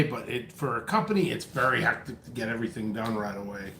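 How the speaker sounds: out of breath. Real, not acted. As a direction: talk while catching your breath. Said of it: "I'm literally out of breath".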